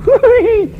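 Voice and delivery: very high-pitched imitation "woo-wee" squeal; mock delighted squeal. Girl at the back door after a kiss, mock delighted squeal. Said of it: "and she goes".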